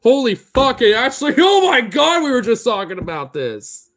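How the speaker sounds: loud, high, laughing disbelief; real excited shouting. Real, not acted. Streamer reacting to a viewer's surprise, loud excited shouting. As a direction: shout it like you can't believe it.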